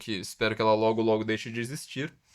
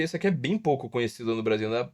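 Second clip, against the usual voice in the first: usual speaking voice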